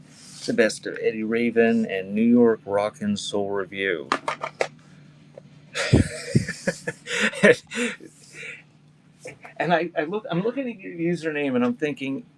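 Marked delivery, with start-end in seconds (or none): 5.7-8.0 laugh. Real, not acted. Laughs at viewer's username.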